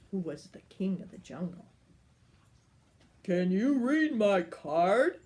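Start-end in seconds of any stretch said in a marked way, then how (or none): 3.2-5.2 big booming giant asking slowly, louder; noisy giant. Acted. The giant asks the lion for help, slow and booming. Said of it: "asked the noisy giant".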